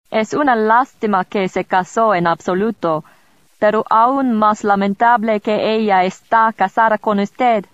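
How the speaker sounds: a sly royal aside; Rudolph in Spanish. Acted.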